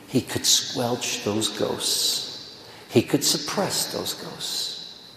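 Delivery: slow, unvoiced whisper; a slow whisper about buried ghosts. Real, not acted. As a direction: hush it down, drawing each word out. Climax about hidden trauma.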